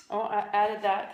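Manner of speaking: ordinary talk, nothing marked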